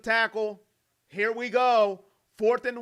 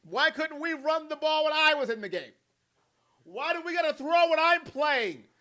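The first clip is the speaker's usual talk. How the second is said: higher whiny quarterback gripe; imagined quarterback sulking, higher; mock Flacco complaint; 6 semitones higher than usual. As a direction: whine like a benched QB. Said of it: "You know what Joe Flacco's thinking".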